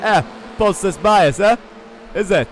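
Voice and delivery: put-on [name]'s South African accent. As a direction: mimic a South African driver teasing. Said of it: "That's what he sounds like, the South African driver".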